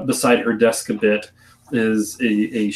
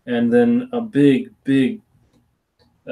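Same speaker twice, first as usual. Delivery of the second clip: usual speaking voice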